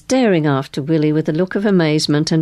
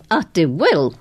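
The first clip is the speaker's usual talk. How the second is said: ordinary delivery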